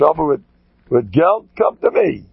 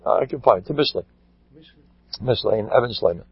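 normal talking voice

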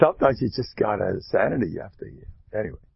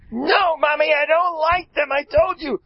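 much higher, louder whiny refusal; bossy child; 6 dB louder than usual. Retold or imitated. Spoiled kid in the shoe store refusing his mother, whiny and loud. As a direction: stamp your foot like a spoiled kid. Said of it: "the kid is in charge".

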